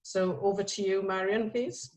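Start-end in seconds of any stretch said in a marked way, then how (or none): none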